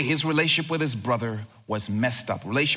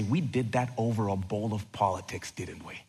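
regretful hush; 4 semitones lower, 7 dB quieter, faster than usual. Pretend. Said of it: "look back and say".

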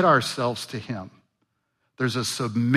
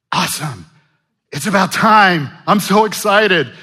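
higher and louder, thrilled at last; excited Moses; 6 semitones higher, 10 dB louder than usual. Acted. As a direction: gush like a man whose rescue finally came. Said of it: "And Moses may have said".

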